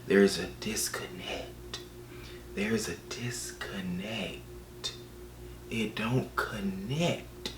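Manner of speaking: slow, soft, drifting toward a whisper; slow-motion hushed emphasis; hushed dramatic verdict. Acted. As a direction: draw each word out quietly for effect.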